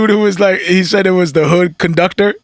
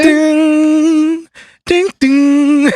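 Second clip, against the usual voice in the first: ding bell; 8 semitones higher, much slower than usual. Pretend. Sound effect bell chime.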